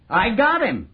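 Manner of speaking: proud crow of success; triumphant. Acted. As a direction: announce the capture with relish. Triumphant announcement of the capture.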